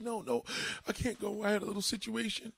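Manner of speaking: high mock-female excuse; upset girlfriend. Voicing upset woman answering her friends.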